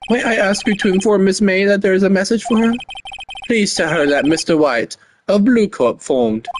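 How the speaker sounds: high, formal bellboy voice. Acted. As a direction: play an obsequious hotel bellboy.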